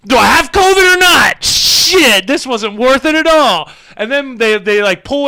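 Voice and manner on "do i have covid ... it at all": exasperated outburst. Retold or imitated. Exasperated retold inner thought.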